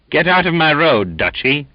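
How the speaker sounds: high, loud rough order; angry shout. Acted. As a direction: roar at him to move. Sailor shoves the silent Finn.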